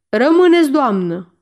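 angry royal command, loud; snapped command. A narrator's reading. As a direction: stop her leaving with a sharp order. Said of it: "se răsti regele".

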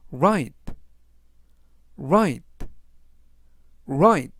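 "right" said three times, clear English R, gh silent; pronunciation drill. Shown as a demonstration. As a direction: model "right" crisply for the class. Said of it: "라고 발음하시면 되겠고요". ("right"를 세 번 또박또박 발음 시범)

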